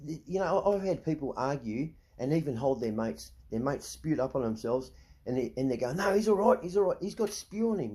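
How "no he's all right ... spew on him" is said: hammed-up drunk pal brushing it off; mock drunk mate. Bouncer mimics drunk patron defending his spewing mate, mock high insistence.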